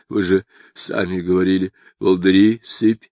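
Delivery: ordinary delivery, nothing marked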